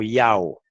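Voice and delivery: "Yao" as ee-A-o, drawn out; reported pronunciation. Shown as a demonstration. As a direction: sound out "Yao" slowly. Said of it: "cómo los judíos pronunciaban el nombre".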